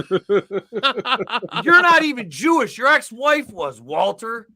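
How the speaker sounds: laughing through a high, loud, slow movie-quote rant; mock furious quote, laughing; Lebowski rant. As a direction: yell it at Walter, cracking up.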